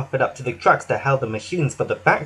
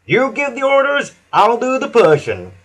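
much higher, louder willing reply; happy to follow orders; eager Hank; 8 semitones higher, 4 dB louder than usual. A narrator's reading. Hank agreeing to push, eager and high. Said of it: "he puffed".

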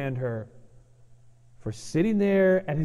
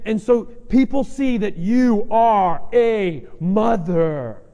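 louder, higher impassioned preaching; preaching at full force; 5 semitones higher, 6 dB louder than usual. Acted. Preacher urging the mothers in the room, louder and impassioned. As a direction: press it home to the moms, louder and higher.

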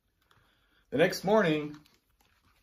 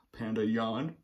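usual speaking voice

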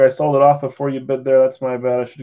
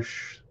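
shushing; 22 dB quieter than usual. Real, not acted. Hushing, wife watching.

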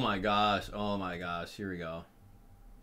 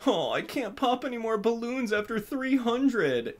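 mocking whiner; 8 semitones higher, 4 dB quieter than usual. Mocking the complaint again.

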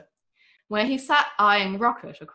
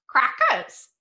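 higher squawky bird saying its name; parrot voice; 6 semitones higher, 5 dB louder than usual. A narrator's reading. The hidden galah speaks from the backpack, parrot voice. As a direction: talk like a galah. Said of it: "said Cassie's backpack".

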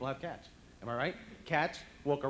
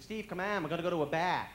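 loud, raised mock Boston accent; 5 semitones higher, 7 dB louder than usual. Boston friends inviting him out, mock Boston accent. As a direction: imitate a Boston friend's broad vowels. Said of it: "between the Boston accent and the Brooklyn accent".

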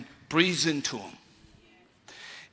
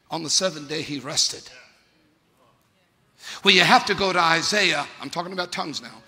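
normal talking voice

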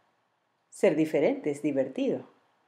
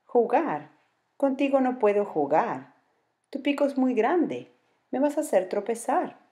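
high, huffy jaguar refusing the toucan; sulky jaguar complaining; grumpy jaguar; 8 semitones higher than usual. Acted. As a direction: dismiss the big-beaked bird.

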